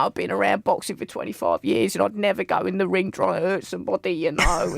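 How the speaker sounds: mild mock Cockney, self-important; a hint of a Cockney caller boasting; imitating Cockney caller. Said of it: "all right at accents".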